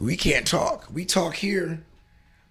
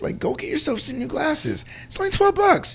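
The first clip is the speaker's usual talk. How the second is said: excited urging. Real, not acted. Urging friend to buy glasses.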